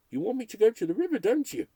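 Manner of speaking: fast, high and loud manic taunt; manic rush. Acted. Broadcaster driven mad, taunting the ghosts, manic and fast. Crazed taunt about river.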